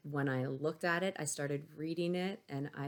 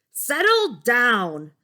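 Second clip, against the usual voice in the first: slow, much higher, loud roar; 8 semitones higher, 15 dB louder, much slower than usual. Acted. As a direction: roar at the class like a monster. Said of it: "Miss Kirby roared".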